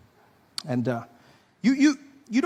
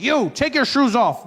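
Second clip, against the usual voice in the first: mock airport security; 6 semitones higher, 6 dB louder, slower than usual. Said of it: "They're like".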